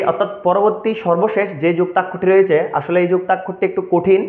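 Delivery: ordinary talk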